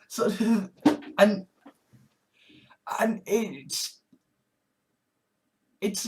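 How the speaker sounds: ordinary talk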